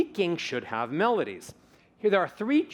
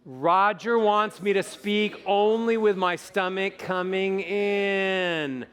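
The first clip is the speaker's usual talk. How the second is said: slow and projected, final word held very long; supported projection; 4 semitones higher, much slower than usual. Shown as a demonstration. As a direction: carry it on the breath and stretch the ending. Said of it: "Take a breath".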